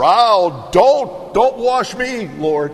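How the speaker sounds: high, loud alarmed plea; Peter protesting. Acted. Peter begging Jesus not to wash his feet, high and loud. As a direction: play Peter recoiling, crying out don't.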